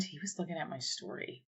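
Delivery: ordinary talk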